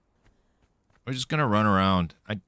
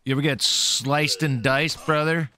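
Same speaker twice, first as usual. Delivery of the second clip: taunting; 6 semitones higher than usual. Acted. Taunting enemy before fight.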